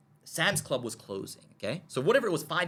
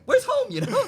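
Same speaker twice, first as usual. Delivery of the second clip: laughing; 8 dB louder than usual. Retold or imitated. Pastor's question retold, laughing.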